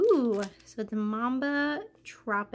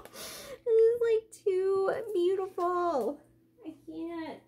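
higher, voice breaking into tears; teary overwhelm; 7 semitones higher, slower than usual. Real, not acted. Crying to viewers over a friend's handmade package. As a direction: choke up, moved by the gift. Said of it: "overwhelmed right now".

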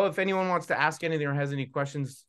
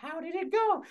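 very high puppet voice; Char Char puppet; 13 semitones higher than usual. Acted. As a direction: greet Magenta in a tiny high voice. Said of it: "Hey, Char Char".

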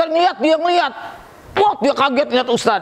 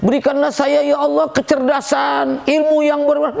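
acted supplication; 5 semitones higher than usual. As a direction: call out a prayer, stretching the words. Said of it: "Doa apa aja minta".

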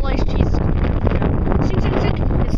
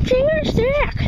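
cheery high toast; 12 semitones higher, 3 dB louder than usual. Real, not acted. Playful toast.

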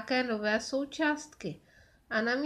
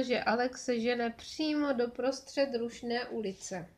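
ordinary delivery

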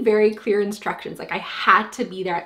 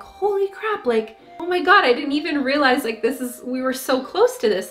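amazed exclaim; 5 semitones higher than usual. Retold or imitated. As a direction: gasp out the wonder of seeing it. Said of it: "And I was like".

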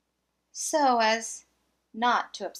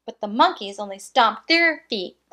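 plain reading voice

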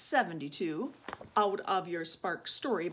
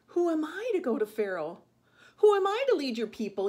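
higher, louder disbelieving plea; dismayed protest; 7 semitones higher, 7 dB louder, faster than usual. A narrator's reading. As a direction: build the question into a panicked protest. Moses protests to God.

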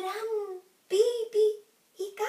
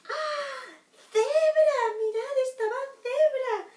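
high, loud gasp of surprise; surprised exclamation; 9 semitones higher, 6 dB louder, slower than usual. A narrator's reading. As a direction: sound astonished to find the zebra there. Surprise reveal in story. (exclamación de sorpresa, aguda y fuerte)

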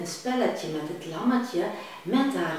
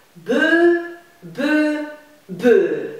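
three loud cow moos; repeated moos; 8 semitones higher, 9 dB louder, much slower than usual. Acted. Storyteller reading to kids, mooing loudly like a cow. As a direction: moo it out three times.

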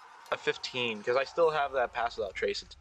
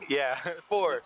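ordinary talk

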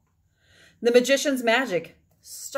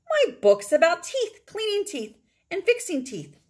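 excited; 7 semitones higher than usual. A narrator's reading. Amy excited about dentistry.

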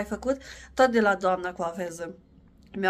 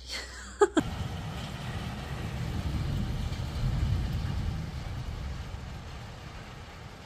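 long breathy laugh; long quiet chuckle; soft laugh; 5 dB quieter than usual. Real, not acted. Mother laughing at costumes.

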